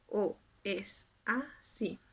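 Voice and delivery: "O es así" slowly in four pieces, o, es, a, sí; split into syllables. Shown as a demonstration. Teacher showing students four syllables, "O es así" broken apart by pauses. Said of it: "serían cuatro sílabas".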